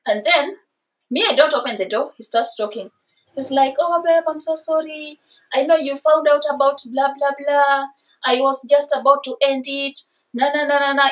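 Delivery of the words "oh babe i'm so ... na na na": droning singsong pleading; mock apology. Boyfriend begging at the door with excuses, mimicked in a whiny drone. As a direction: reel off his excuses in a mocking monotone.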